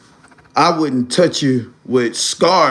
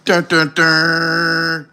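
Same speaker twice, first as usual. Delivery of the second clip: mimicked dropped call tone; much slower than usual.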